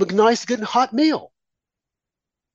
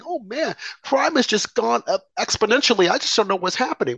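higher, whiny mock of a bewildered liberal; mock clueless liberal; 6 semitones higher than usual. Mocking liberals now baffled by crime, higher and whiny. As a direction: play dumb and helpless in a higher voice. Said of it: "same diehard liberals that were crying are saying".

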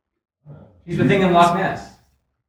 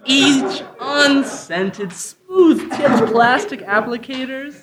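mock commercial read; 2 semitones higher than usual. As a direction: sell it like a TV ad. Improv sketch fake tampon ad.

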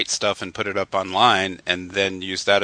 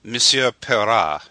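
put-on slow, grand French accent; 2 semitones higher than usual. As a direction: Monsieur Perra like a French host. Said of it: "over to you, Jim".

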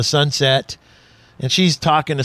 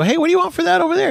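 eager question; 12 semitones higher, much faster than usual. Retold or imitated. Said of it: "I go".

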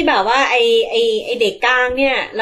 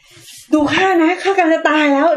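mock war boy bravado; 4 semitones higher, 3 dB louder than usual. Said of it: "ทุกคนพร้อมที่จะตายแล้วบอกว่า".

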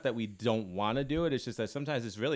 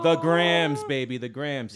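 hype voice; 3 semitones higher, 8 dB louder, slower than usual. Acted. Hyping their Instagram.

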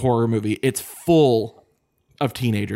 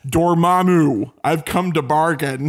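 mock Doctor Strange; 4 semitones higher, slower than usual. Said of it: "Doctor Strange quote".

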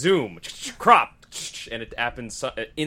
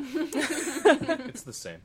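laughing; much slower than usual. Real, not acted. Laughing reply to joke.

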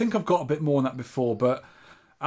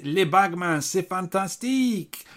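mock French; 5 semitones higher, slower than usual. Reads a listener's French line.

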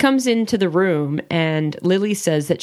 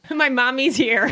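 childish mock voice; 7 semitones higher, slower than usual. Teasing, childlike voice.

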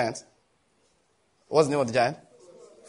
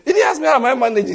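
normal talking voice

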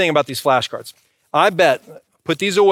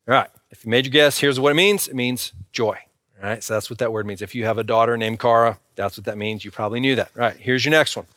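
normal talking voice

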